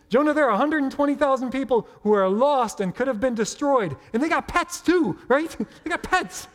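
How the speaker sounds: high and amused; chuckling at the joke about pets; laughing. Real, not acted. Preacher laughing to the congregation about animals.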